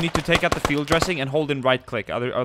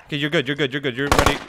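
ordinary delivery

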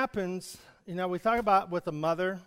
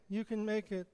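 much softer echo of the call; 2 semitones higher, 7 dB quieter than usual. Shown as a demonstration. Preacher echoes the encouragement softly. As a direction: repeat it quietly like an echo. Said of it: "what you send out".

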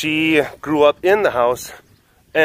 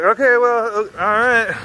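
much higher, awkward resigned okay; deflated okay; 10 semitones higher than usual. Retold or imitated. Told they need no help, awkward and deflated. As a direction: back off awkwardly. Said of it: "And I'm like".

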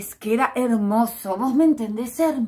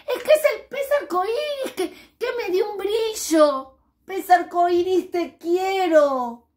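happy little fish thanking the rainbow fish; overjoyed little fish; 10 semitones higher, 6 dB louder than usual. Acted. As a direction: gush with delight and gratitude. Little fish joyfully explains.

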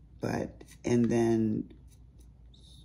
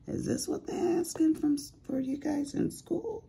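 much higher amazed question; high incredulous. Retold or imitated. Mom asking son about schoolwork, high astonished voice. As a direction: sound stunned by the homework. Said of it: "I'm like".

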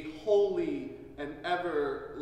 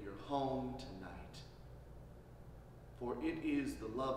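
soft, low reverent prayer; 3 semitones lower, 6 dB quieter, faster than usual. Acted. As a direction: pray quietly over the ashes. Leads a prayer.

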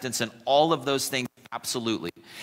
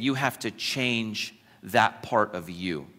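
gentle, earnest; 4 semitones lower, 5 dB quieter than usual. Acted. Jesus paraphrased, soft and slow.

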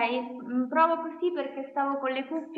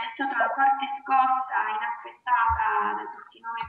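usual speaking voice

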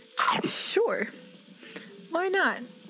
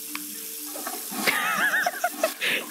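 airy giggle. Real, not acted. Crab won't cooperate.

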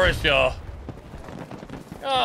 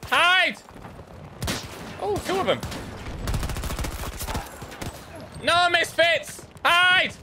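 urgent call; 9 semitones higher, slower than usual. Real, not acted. Urging squad to hide.

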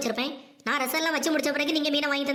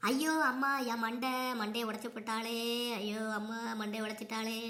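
much softer, lower groaning lament; mock wailing; 4 semitones lower, 8 dB quieter than usual. Mother-in-law struck on the head, moaning in pain. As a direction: wail weakly over the broken head.

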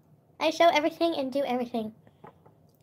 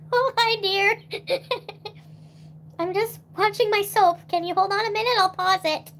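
put-on higher, louder old lady voice; old grandmother; 7 semitones higher, 4 dB louder than usual. Grandmother answering a phone call, old lady voice. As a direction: play a sweet old granny on the phone. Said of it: "Every time I call my grandmother".

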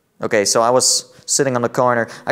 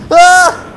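scared scream; 11 dB louder than usual. Real, not acted. Scared by creature.

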